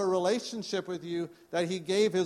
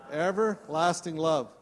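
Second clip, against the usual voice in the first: usual speaking voice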